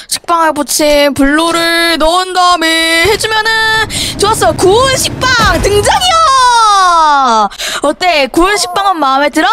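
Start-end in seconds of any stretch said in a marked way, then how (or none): 4.5-7.5 slow, drawn-out announcer call; hammed-up announcement. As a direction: announce the toast like a game show host. Announcing toast again. (과장된 발표 톤)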